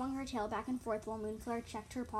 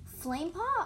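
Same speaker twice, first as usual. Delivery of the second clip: high, loud questioning call; raised, rising shout; louder repeat; 8 semitones higher, 7 dB louder, slower than usual. A narrator's reading. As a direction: repeat the name higher and louder. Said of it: "I repeated louder".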